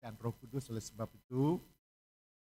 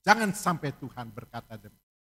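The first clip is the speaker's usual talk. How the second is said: preaching at full force; 13 dB louder than usual. Acted. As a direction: stretch each phrase out to the crowd. Preacher exhorts, slow and loud.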